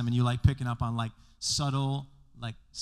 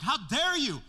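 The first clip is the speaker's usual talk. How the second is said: mock outrage; 10 semitones higher, 5 dB louder than usual. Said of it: "they're like".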